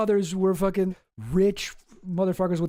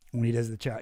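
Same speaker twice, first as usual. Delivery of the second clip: usual speaking voice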